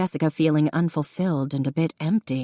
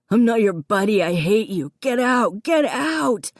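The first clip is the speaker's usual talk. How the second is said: raging shout; 5 semitones higher than usual. A narrator's reading. Davy raging at Connor to leave. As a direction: throw him out with your voice.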